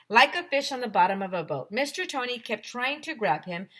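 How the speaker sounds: plain reading voice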